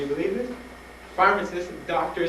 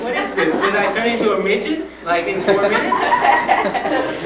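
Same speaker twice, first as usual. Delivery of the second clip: loud, high comic indignation; hammed-up outraged questioning; exasperated retort; 7 semitones higher, 10 dB louder, slower than usual. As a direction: protest in disbelief, near shouting. Retelling exasperation at doctor's repeated question.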